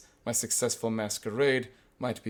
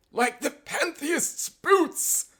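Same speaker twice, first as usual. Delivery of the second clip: very high, very slow nonsense retort; drawn-out falsetto comeback; mock professor; 4 dB louder, much slower than usual. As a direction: squeal it out word by word. Retold nonsense retort in put-on voice.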